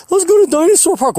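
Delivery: high-pitched silly enthusiasm; hammed-up high voice; mock eager sightseer. Mocking people keen on tourist attraction.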